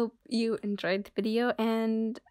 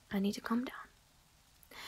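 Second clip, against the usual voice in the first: whispered to herself; 8 dB quieter, slower than usual. Acted.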